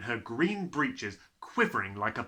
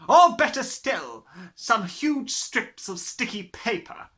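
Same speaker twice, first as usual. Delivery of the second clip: Trunchbull's booming voice; 7 semitones higher, 6 dB louder than usual. A narrator's reading. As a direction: sneer at the children from the front. Trunchbull taunting Miss Honey.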